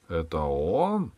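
медленное «Это он?» с резким подъёмом на «он»; растяни «он» вопросом, голос вверх; вопросительная интонация. A narrator's reading. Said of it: "спросил король". («Это он?» медленно, с вопросом на «он»)

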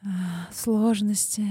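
erotic ASMR whisper. Pretend. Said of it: "А С М Р".

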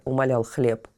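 ordinary delivery, nothing marked

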